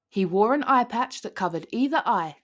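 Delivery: usual speaking voice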